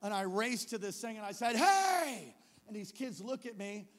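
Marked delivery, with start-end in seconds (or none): 1.6-2.3 high, loud drawn-out holler; commanding shout. Retold or imitated. To high schoolers in a knife fight, commanding shout. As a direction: stop them with one yell.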